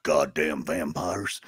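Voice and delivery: low, slow grumbled curse; grandpa quote. Retold or imitated. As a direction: imitate grandpa's line, low and drawn out. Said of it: "when grandpa".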